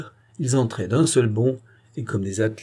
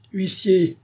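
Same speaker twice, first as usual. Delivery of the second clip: shouted call; 9 semitones higher than usual. A narrator's reading. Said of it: "cria Thuriot".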